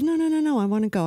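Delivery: high-pitched, rapid mock protest; retold daughter's eager insistence; insistent protest. Daughter refusing to stay behind, insistent.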